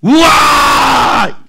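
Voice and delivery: long, high, loud drawn-out wow; stretched shout of wonder; exclamation of awe. Retold or imitated. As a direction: cry out in amazement, very slowly. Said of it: "이러면서 여기서 탄성이".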